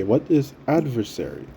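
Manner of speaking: ordinary talk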